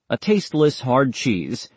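plain reading voice

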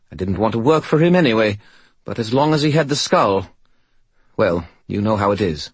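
high, quick cheeky sidekick; chirpy skull voice. Acted. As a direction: play a cheerful talking skull. Bob the Skull talking.

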